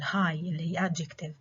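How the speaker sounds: ordinary delivery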